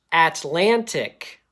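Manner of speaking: ATLANTIC loud and slow, both t sounds crisp; carefully over-enunciated. Shown as a demonstration. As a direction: pronounce every sound in Atlantic, at-lan-tic. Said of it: "really pronounce all those sounds".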